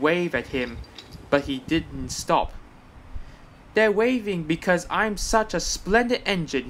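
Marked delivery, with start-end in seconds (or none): 3.7-6.7 self-important. A narrator's reading. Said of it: "he thought importantly".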